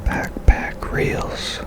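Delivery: ordinary talk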